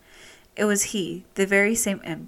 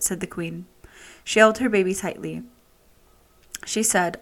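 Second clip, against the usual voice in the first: ordinary delivery, nothing marked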